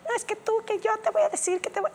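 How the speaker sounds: high-pitched sputtered protest; protesting boyfriend. Retold or imitated. Boyfriend objecting to being dumped, mocking high sputter. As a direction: mimic a boyfriend scrambling for arguments.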